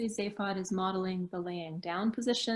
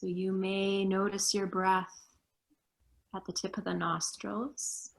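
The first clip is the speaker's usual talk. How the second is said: guided meditation. Acted. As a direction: guide them gently into stillness. Leads a meditation.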